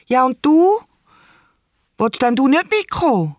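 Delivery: put-on high, let-down child's plea; disappointed little girl. A narrator's reading. Isa asking her uncle to come along, disappointed. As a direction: ask it like a small girl whose hope just dropped. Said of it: "fragt Isa ganz enttäuscht".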